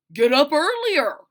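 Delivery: loud dismissive dad; gruff father. Acted. Father brushing off his daughter, loud and curt. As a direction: snap the order back.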